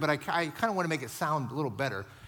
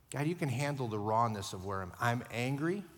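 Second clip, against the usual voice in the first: ordinary delivery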